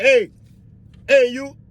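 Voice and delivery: high hail to an inmate; guard calling out. Acted. Guard rousing an inmate in his cell, calling out. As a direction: call sharply to get their attention.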